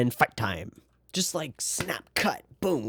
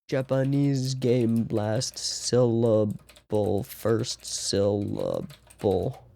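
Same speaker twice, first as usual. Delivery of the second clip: slow, searching for the word; much slower than usual. Real, not acted. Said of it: "I forget what it's called".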